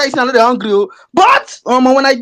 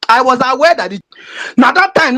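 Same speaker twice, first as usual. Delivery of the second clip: ordinary talk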